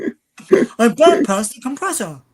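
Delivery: loud, slow, badly imitated accent; Rey's awful accent. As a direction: belt out a hammed-up, awful accent slowly. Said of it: "that's my awful, uh, Rey, uh, accent".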